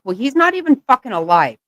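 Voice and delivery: ordinary talk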